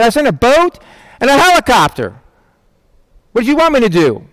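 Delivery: high, incredulous punchline as God; exasperated God. Acted. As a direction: protest as if baffled by the complaint. God's incredulous punchline in joke.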